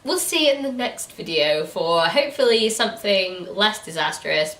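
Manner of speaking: ordinary delivery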